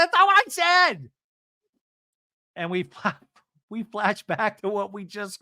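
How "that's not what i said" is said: high, loud exasperated imitation; mock shouted denial. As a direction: yell it like someone misquoted, hammed up. Acting out Emily's shouted denial.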